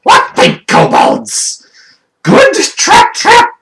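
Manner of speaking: slow, loud yell leaping very high; yelling to crowd. Acted. Offneeds asks the kobold crowd for a verdict, loud screeching yell. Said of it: "who yells to the crowd".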